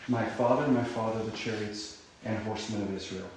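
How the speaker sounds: quiet, thoughtful repetition; quiet musing. Shown as a demonstration. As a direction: ponder the words quietly. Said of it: "what does it mean".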